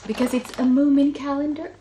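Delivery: a burst of excitement over a favourite; excited reveal. Real, not acted. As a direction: show it off with real glee. Excited reveal of Moomin calendar.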